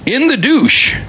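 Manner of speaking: slow, bright ad announcer read; chipper 1950s ad. Acted. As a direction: sell it like a fifties commercial. Reads old ad aloud.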